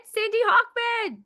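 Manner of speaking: big, high, loud announcement of the name; excited introduction. Real, not acted. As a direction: announce her like a star. Host introduces poet with flourish.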